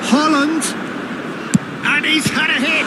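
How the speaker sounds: high, urgent commentary; excited shot call. Real, not acted. Commentator calling Haaland's hit on goal, excited. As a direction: jump up in pitch as the shot comes.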